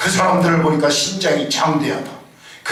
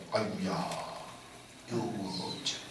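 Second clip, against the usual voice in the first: much lower, softer whining; mock lament; 9 semitones lower, 18 dB quieter than usual. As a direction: moan it like a defeated complainer. Complaint whined, fading. (낮고 힘없는 한탄 흉내)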